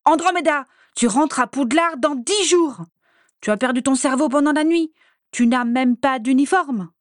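high, loud and exasperated; loud scolding. Acted. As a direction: scold her at full volume. Bellatrix berating her sister.

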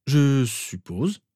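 slow, drawn-out, uncertain answer; hesitant. A narrator's reading. Harry asked whether he likes the robes, hesitant. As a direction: stretch it out as if unsure. Unused to choosing his clothes.

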